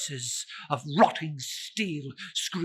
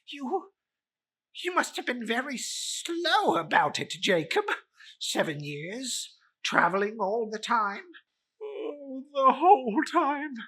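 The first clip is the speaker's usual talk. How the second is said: higher-voiced Scrooge; 10 semitones higher than usual. Scrooge questions ghost.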